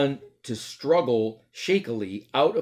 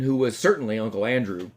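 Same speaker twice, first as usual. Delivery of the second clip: ordinary delivery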